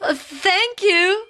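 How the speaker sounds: high, hesitant little thank you; shy, unused to kindness; timid thanks. Acted. Mister Good timidly thanks the woman, high and hesitant. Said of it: "said Mister Good".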